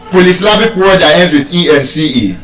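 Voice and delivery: ordinary talk, nothing marked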